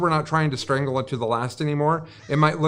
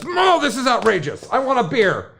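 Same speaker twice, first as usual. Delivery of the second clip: raised, pushy bear demanding a drink; 8 semitones higher than usual. Retold or imitated. Joke bear protesting to the bartender, hammed-up and higher. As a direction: play the indignant bear, pitched up. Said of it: "And the bear says".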